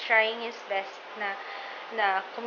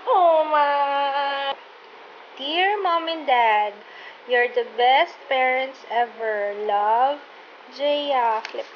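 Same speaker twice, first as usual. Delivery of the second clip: reading slowly, near crying; choked-up reading of a sweet note; teary, slow, louder reading; moved to tears; 2 semitones higher, 4 dB louder, much slower than usual. Real, not acted. Reading child's card, moved.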